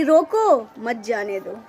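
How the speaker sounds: रोको stands alone as the command; called from afar. Retold or imitated. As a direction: call out रोको, break, drop on मत जाने दो. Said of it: "ये दूर से कहा कि".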